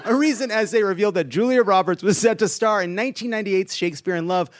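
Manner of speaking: ordinary delivery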